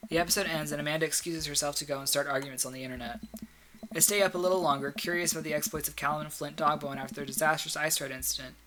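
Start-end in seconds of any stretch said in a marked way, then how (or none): none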